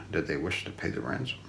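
ordinary delivery, nothing marked

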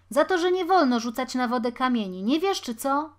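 fast, loud, high telling-off; scolding. A narrator's reading. Taller boy forbidding stones in the water, scolding. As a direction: lay down the rule heatedly. Boys' quarrel escalates.

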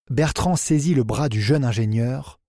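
usual speaking voice

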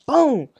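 high, loud spoken impact; imitated crash. Storyteller showing cops pushing his car, high loud crash imitation. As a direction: burst out the crash noise, pitch way up. Imitating second push from police.

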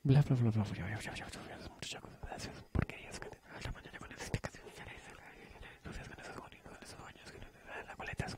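susurro rápido, muy bajo, de cháchara; cuchicheo. Acted. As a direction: cuchichea deprisa, casi sin voz. Cuchicheo de fondo entre compañeros. (murmullo atropellado de chismorreo)

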